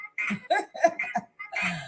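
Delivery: high soft laugh at her own joke; amused giggle, high and soft; real laugh. Real, not acted. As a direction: let a light giggle escape.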